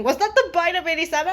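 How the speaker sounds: ordinary talk, nothing marked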